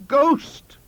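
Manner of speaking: very high, loud shriek; outraged scream. A narrator's reading. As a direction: scream back at the insult. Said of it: "I screamed".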